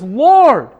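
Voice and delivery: loud pleading cry, Lord stretched. Acted. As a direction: beg the Lord for help out loud. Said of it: "The woman cries out and says".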